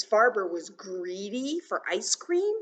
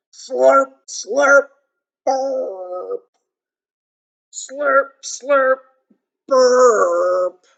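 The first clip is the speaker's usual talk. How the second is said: mock slurps and burp; 5 semitones higher, 16 dB louder, much slower than usual. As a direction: play a gluttonous slurp and belch. Acts out the bee's noisy eating.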